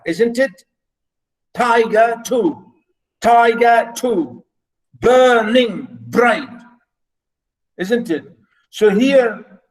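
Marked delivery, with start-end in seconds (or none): 1.5-6.5 slow scansion, each word in clear beats; counting out syllables. Shown as a demonstration. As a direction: read it slowly, marking every syllable. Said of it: "that's how we do it".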